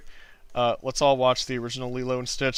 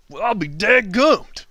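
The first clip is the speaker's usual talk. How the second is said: hammed-up folksy exclaim; 7 semitones higher, 6 dB louder, slower than usual.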